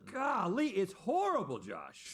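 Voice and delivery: high, loud gagging golly; disgusted outburst. Real, not acted. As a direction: recoil from a foul stench. Reacting to the stink.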